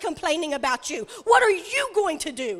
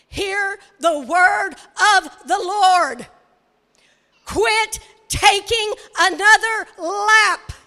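slow, loud, drawn-out preaching; preaching shout; 2 semitones higher, 5 dB louder, slower than usual. Real, not acted. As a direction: bear down on every word like a proclamation. Preacher exhorting the congregation.

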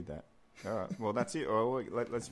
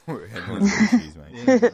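laughing; 10 semitones higher, 13 dB louder, slower than usual. Real, not acted. Laughing mock reply about issues.

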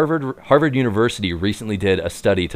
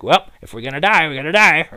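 quick, higher-pitched mock fatalism; old-time fatalist voice; 6 semitones higher, faster than usual. Host voicing reckless tank builders of 1919, mock fatalistic. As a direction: put on a breezy caricature of a resigned townsperson.

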